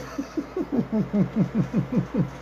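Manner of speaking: a quick real laugh while waiting; soft amused laugh; short laugh. Real, not acted. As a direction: let a laugh slip out.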